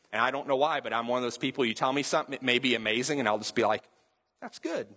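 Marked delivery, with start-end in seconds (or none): 4.4-4.9 unexcited reply. Retold or imitated. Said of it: "I don't get very excited".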